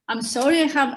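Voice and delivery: normal talking voice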